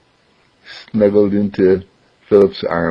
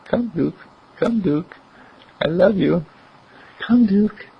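high, much softer, loving call; quiet loving call; 10 semitones higher, 4 dB quieter than usual. Acted. Philip calling the dog in his heart, soft and loving. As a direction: coax him home gently. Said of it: "Just quietly, lovingly, gently".

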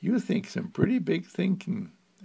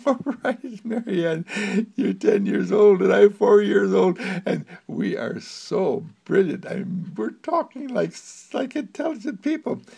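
put-on high, giggly little boy, proud; a four-year-old showing off, high and eager; Jerome's voice; 8 semitones higher, 6 dB louder than usual. Jerome boasting to Marianne about being brilliant, in a high child's voice.

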